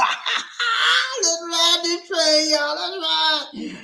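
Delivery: high, loud, stretched hype; hyped up. Real, not acted. Host hyping viewers to ride the train, excited. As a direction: get the chat riding the train.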